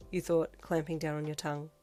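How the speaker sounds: ordinary delivery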